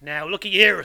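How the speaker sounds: loud, commanding demand for attention; menacing convict. A narrator's reading. Convict begins questioning boy, menacing. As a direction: take charge of the child.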